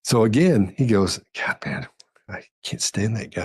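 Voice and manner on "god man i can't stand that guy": hushed, conspiratorial aside; confiding whisper. Retold or imitated. Agent confiding to the bank robber, whispering. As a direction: whisper it like a shared secret. Confiding against the other cop.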